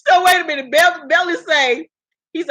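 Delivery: ordinary talk, nothing marked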